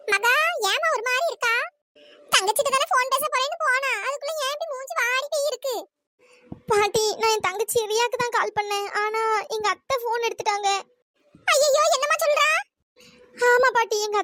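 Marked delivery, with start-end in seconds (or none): 0.1-5.8 put-on higher old grandmother voice, gently worried; a caring granny checking on her granddaughter; concerned grandmother. Grandmother asking Maha why she looks upset, concerned. 11.5-12.5 higher, louder shocked cry of ayyayo; granny startled by the news; alarmed gasp. Acted. Grandmother shocked at Maha's news, alarmed.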